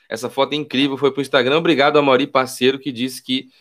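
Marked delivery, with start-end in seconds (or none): none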